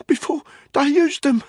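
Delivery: high, strained gasping; barely managed. A narrator's reading. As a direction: force it out while shaking. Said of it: "he managed to say".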